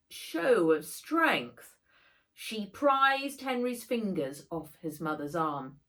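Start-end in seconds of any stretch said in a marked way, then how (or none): none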